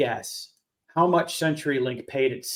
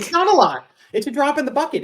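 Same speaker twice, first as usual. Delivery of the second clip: exasperated; 10 semitones higher, faster than usual. Real, not acted. Emphatic agreement.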